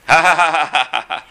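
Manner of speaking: loud spelled-out ha ha ha; mock laughter. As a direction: laugh it out, relieved at rock bottom. Rabbi laughs at bad news.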